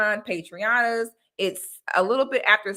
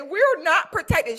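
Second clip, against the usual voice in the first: mocking quote; 7 semitones higher than usual. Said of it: "have a nerve to say".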